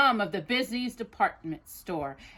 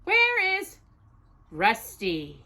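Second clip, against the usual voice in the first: dramatic title reveal, big rise on where; storybook title announcement; announcing the title; slower than usual. Acted. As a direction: present the book title with flair. Reads title with flourish.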